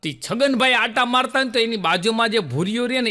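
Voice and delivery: normal talking voice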